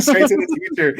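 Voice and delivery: normal talking voice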